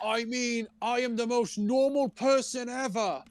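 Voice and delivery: high-pitched mock protesting boyfriend; Harden impression. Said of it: "Harden's like".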